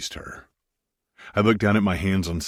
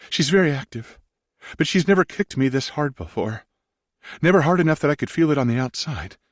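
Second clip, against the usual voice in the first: higher woman's voice, amazed; wide-eyed question; 9 semitones higher than usual. Acted. Wife asks Maximus if he felt the baby kick, amazed. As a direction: ask it pitched up with wonder.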